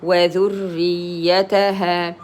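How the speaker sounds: slow وذريتها with long final aa; two-count alef. Shown as a demonstration. Teacher models alef with two periods, slowly prolonging وذريتها. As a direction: hold the last vowel of وذريتها two beats. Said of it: "you give it two periods".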